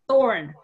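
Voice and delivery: English th in thorn. Shown as a demonstration. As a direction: say thorn with the tongue between the teeth. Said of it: "مثل انگلیسی ث داریم".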